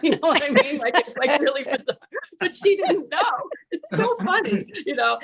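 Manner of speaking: high-pitched real amusement through laughter; amused, laughing. Real, not acted. Teller amused that the guide didn't know, laughing. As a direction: talk through the giggles, pitch climbing.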